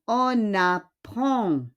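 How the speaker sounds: "on apprend" slow, N of on linked onto the A; teacher's slow "on apprend" with silent D; liaison. Shown as a demonstration. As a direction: draw out "on apprend" as on-napprend.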